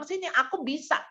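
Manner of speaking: a hint of a small child insisting. Toddler wanting to do it alone, lightly childlike. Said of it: "anak usia dua sampai empat".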